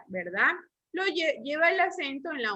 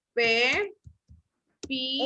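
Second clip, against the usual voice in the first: Pe, pi, each held and set apart; syllable by syllable. Shown as a demonstration. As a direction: pause between Pe and pi, as if dictating. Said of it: "Lo separo en sílabas".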